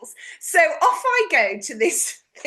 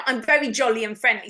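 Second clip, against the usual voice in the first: usual speaking voice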